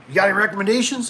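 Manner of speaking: high, quick hopeful question; lightly voiced eager young guy; fast, high plea to a business partner; asking for help. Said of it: "I'm like".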